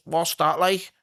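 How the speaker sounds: a hint of a high-voiced Scouse heckler; mock heckling lad. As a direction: imitate a doubtful lad in the pub. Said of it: "And he went".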